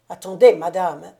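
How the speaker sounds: loud furious snap at his wife; the count, flushed with rage, loudly cuts off his wife; angry outburst. A narrator's reading. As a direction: burst out in anger, stamping. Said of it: "rougissant de colère et frappant du pied".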